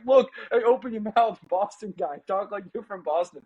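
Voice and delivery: imitated heckling. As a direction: act out someone goading a guy to talk. Acting out commercial's heckling dialogue.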